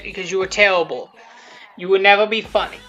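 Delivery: ordinary talk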